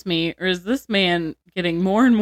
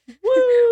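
high falling whoop cry; mock whoosh; 5 dB louder than usual. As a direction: whoop her down the chimney. Imitated falling sound.

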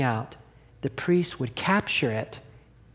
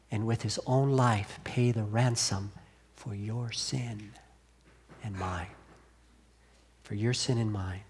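soft, slow, sinking reverence; solemn and hushed; 6 semitones lower, 7 dB quieter than usual. Acted. Preacher bringing the congregation to the cross, softly and slowly. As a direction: drop the voice low and let it fade.

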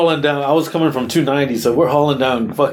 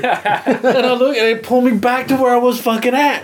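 laughing while telling; 11 semitones higher than usual. Real, not acted. Laughing through the retelling.

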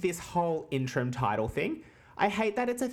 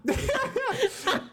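high laugh. Real, not acted. Laughing in the funny voice.

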